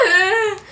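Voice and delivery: loud high crying wail; mock sob. Teller reenacting crying in the theater, loud mock sob. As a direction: let out a hammed-up sob. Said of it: "And I was like".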